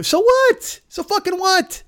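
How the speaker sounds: high, sharp so what; exasperated high-pitched comeback; shrill retort. Real, not acted. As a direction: fire it back in disbelief. Retort to fans.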